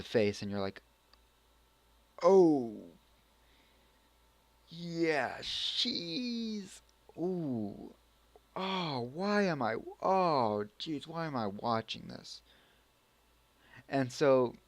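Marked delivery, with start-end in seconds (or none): 2.2-12.4 higher, drawn-out cringing groans; mock dismay. Vlogger acting out discomfort at a nude intro, mock dismay. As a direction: act out an awkward, appalled reaction. Acted embarrassed reaction to nude scene.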